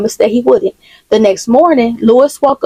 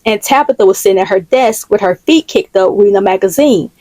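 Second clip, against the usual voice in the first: usual speaking voice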